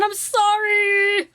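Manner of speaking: playful shouted apology with a stretched ending; exclaimed apology. Real, not acted. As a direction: call out an exaggerated apology. Mock-dramatic apology for long video.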